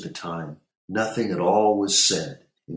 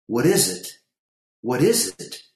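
high, louder repeated question; insistent demand; 7 semitones higher, 4 dB louder than usual. A narrator's reading. Mother questioning her upset brother, insistent. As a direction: press harder for an answer each time.

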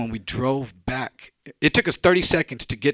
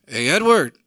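louder calling voice; 4 semitones higher, 8 dB louder than usual. Retold or imitated. Freddie calling Edward from outside, loud. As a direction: call him from outside.

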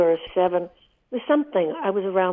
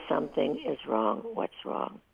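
softer, whispered worried question; hushed concern; 9 dB quieter than usual. Retold or imitated. Husband softly asking his wife what's wrong, in a whisper. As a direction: ask gently, almost a whisper.

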